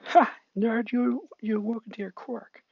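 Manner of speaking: put-on high, taunting kid voice; smug bully. Bakugo teases Deku about his new quirk, high smug voice. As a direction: jeer at the nerd.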